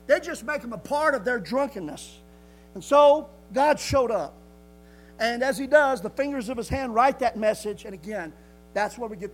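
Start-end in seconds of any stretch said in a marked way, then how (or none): 2.8-4.3 dramatic declaration. Acted.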